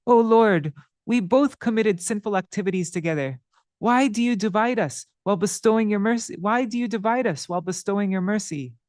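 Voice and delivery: pleading sinner. Acted. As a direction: beg for mercy, voice lifted. Madhai pleads to the Lord.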